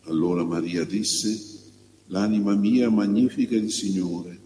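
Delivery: measured, slightly lifted liturgical reading voice; solemn church lectern reading; Gospel reading. Real, not acted. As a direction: proclaim scripture phrase by phrase, with clear pauses. Proclaims the Gospel before the homily.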